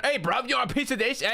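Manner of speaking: high, far-off cockney bug voice picking a fight; squeaky British bug squaring up to fight; angry British bug. Retold or imitated. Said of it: "bugs become British ... they start going".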